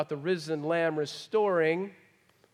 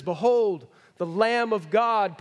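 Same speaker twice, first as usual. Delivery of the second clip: louder, slow proclamation; John the Baptist crying out to the crowd, loud and slow; proclaiming behold; 3 semitones higher, 6 dB louder than usual. Retold or imitated. Preacher voices John calling out, loud and slow.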